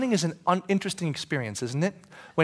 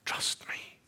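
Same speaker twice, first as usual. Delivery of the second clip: soft breathy plea; whispered Jesus; 8 dB quieter than usual. Acted. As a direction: whisper it close. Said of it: "And he's going".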